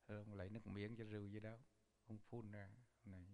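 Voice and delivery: ordinary talk, nothing marked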